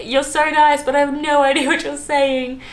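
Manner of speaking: polite bewildered tourist. Retold or imitated. Telling Newcastle taxi driver, confused.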